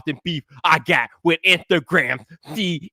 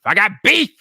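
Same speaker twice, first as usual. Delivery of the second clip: playful hype; hyped-up declaration; 7 semitones higher, 4 dB louder than usual. Shown as a demonstration. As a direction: punch it louder and higher. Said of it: "playful teaser intro".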